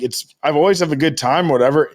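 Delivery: ordinary talk, nothing marked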